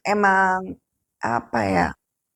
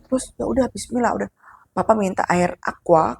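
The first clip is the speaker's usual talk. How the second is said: ordinary delivery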